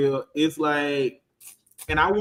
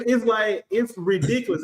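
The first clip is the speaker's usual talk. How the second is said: ordinary talk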